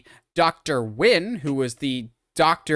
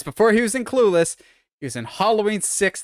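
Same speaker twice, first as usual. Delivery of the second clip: ordinary talk, nothing marked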